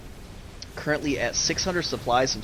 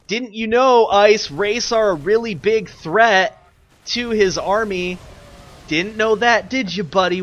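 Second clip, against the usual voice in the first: sarcastic; 6 semitones higher, 7 dB louder, slower than usual. Real, not acted. Mocking player over a mistake.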